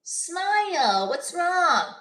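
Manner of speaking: high mocking smile what's wrong; guy saying smile. Pushy stranger to a woman, high mocking voice.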